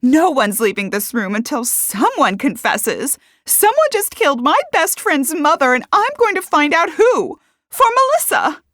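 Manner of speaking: high, loud, building to a shout; furious vow. A narrator's reading. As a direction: lay down the law to the room. Accuser vows to find killer.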